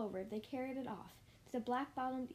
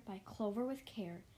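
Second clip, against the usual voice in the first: plain reading voice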